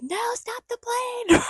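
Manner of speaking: high, drawn-out hammed-up shout; desperate mock scream; mock yell. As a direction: cry out after a departing plane. [name] yelling at escaping plane.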